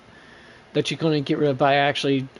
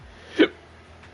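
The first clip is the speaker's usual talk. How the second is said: sharp hiccup gasp; a sudden involuntary hiccup. Real, not acted. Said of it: "Sorry, got the hiccups".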